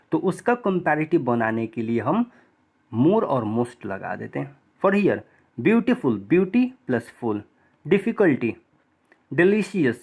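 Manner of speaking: ordinary talk, nothing marked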